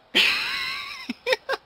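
shrieking laugh at himself; high laugh. Real, not acted. Youtuber laughing at his own botched takes, shrill.

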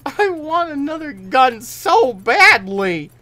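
high, loud, slow whine; whiny wail. Real, not acted. Whining after losing gun.

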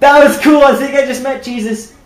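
bright laughing comment. Real, not acted. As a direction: say it mid-laugh. Laughing after surprise.

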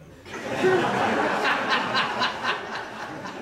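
high, loud laughter at his own joke; cracking up at his own punchline; real laugh. Real, not acted.

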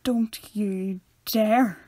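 very slow, measured mock warning; mock-stern, dragged-out reproach; cringing warning. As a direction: draw out each word in warning. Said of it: "I was just cringing".

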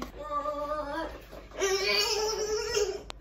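drawn-out high whine breaking into laughter; wail, then crack up laughing. Pretend. Vlogger reacts to botched baking, laughing wail. Baking attempt going wrong.

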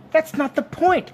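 very high, loud, drawn-out objection; slow high-pitched protest. A narrator's reading. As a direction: object indignantly, pitch right up. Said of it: "Arthur protested".

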